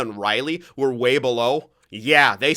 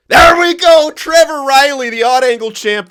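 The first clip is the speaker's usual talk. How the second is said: much higher, loud joyful shout; goal celebration; 14 semitones higher, 14 dB louder than usual. Real, not acted. Gamer celebrates a goal scorer, shouting with joy. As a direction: erupt like your team just scored.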